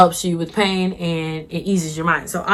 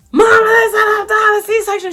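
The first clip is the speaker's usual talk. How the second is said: loud, high-pitched mock wail; exaggerated tearful cry for help; crying to mom; 6 dB louder than usual. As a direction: bawl it down the phone to mom. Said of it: "I was crying".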